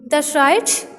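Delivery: "That's right" climbing on "right", as if asking; questioning lift at the end of "right"; question intonation. Shown as a demonstration. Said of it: "इसका main idea जो है वो change है".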